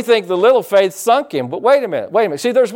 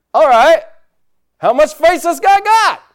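high, louder mock doubt; sizing up the guy, incredulous; half-joking skeptic; skeptical quote; 9 semitones higher, 5 dB louder than usual.

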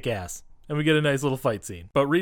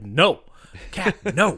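mock scolding; 5 semitones higher, 5 dB louder, much slower than usual. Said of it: "he really does say it like".

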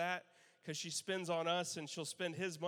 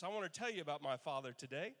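normal talking voice

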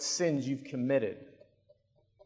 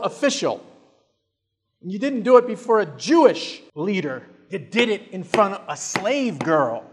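condemnation building from restrained to loud, high-pitched outrage; self-righteous, judgmental; 4 semitones higher, 6 dB louder than usual. Acted. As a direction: start measured, then climb into a hammering denunciation.

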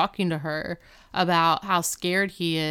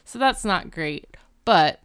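ordinary delivery

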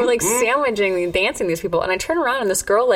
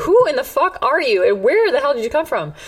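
raised-pitch indignant questions; retold angry demand; 6 semitones higher than usual. Woman looking down at the shorter girl, high indignant demand. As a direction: act out sizing up a rival, voice lifted high.

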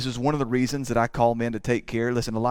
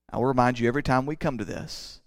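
usual speaking voice

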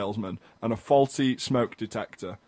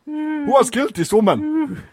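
Kony voice; 11 semitones higher, 4 dB louder than usual. Acted. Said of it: "can I stop doing the Kony voice".

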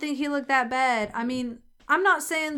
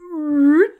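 loud pained squeak; pained yelp; 5 semitones higher, 11 dB louder than usual. Retold or imitated. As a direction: yelp as your arm gets twisted. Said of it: "he totally is just like".

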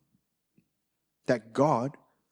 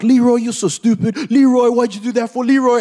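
higher, louder scolding that climbs each time; exasperated teammates; 6 semitones higher, 4 dB louder than usual. Retold or imitated. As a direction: berate him, rising with each Leroy. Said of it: "they're all like".

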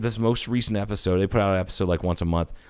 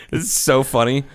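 ordinary talk

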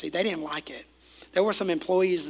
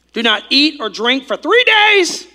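call-and-response line; 6 semitones higher, 8 dB louder, slower than usual. Shown as a demonstration.

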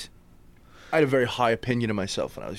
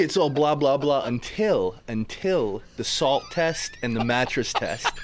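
ordinary talk

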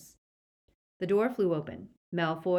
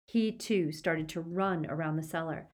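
ordinary delivery, nothing marked

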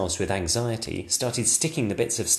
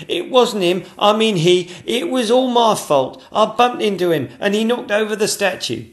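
high, loud, earnest confession; eager footman; 12 semitones higher, 8 dB louder than usual. Acted. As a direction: rush to take blame.

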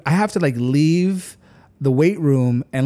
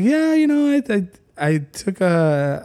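groggy mock reply; 6 semitones higher than usual. Telling David he's fine while groggy, high and dazed. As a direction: play it like you're foggy from sleeping pills, voice up high. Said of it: "And I was like".

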